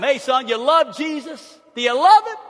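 the father quizzes his son about Jesus, loud and high; Italian dad. Retold or imitated. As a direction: call it out like a proud Italian papa. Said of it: "he just would ask me growing up".

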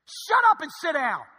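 high, loud acted outburst; angry shout. Gate agent to over-cheerful passenger, angry shout. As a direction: shout them down abruptly.

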